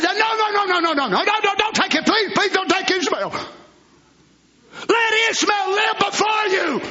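high, shouted desperate begging; pleading Abraham. Acted. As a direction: beg God as Abraham, all-out. Abraham begging God for Ishmael.

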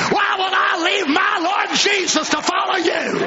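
high, shouted defiance at the devil; defiant shout. Acted. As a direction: yell it right back in the devil's face. Defying the devil from pulpit.

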